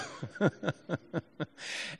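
real, breathy laugh to oneself; helpless laugh. Real, not acted. Preacher cracking up at his own point, helpless laughing. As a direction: laugh helplessly, unable to stop. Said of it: "I can't help myself".

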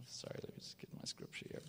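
hushed whisper off to the side; quick whispered aside while searching the page; whispered, fast aside. Real, not acted. Aside while finding scripture.

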